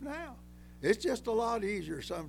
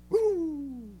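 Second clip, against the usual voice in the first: high, loud whooping woo; woo exclaim; 8 semitones higher, 5 dB louder than usual. Real, not acted. Preacher whooping to the congregation after recalling the insult.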